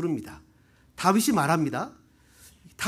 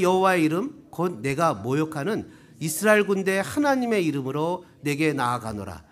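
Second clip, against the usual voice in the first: slow, lower-pitched solemn reading; scripture reading; 4 semitones lower than usual. Acted. Preacher reading scripture to the congregation, slow and measured. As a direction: read the verse out phrase by phrase, slowly. Reads the scripture passage aloud.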